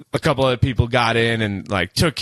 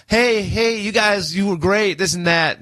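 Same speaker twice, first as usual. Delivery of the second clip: high, sing-song fake-friendly voice; butter them up in a bright girlish tone; mock sweet sister voice; imitating a gushing girl; 8 semitones higher than usual.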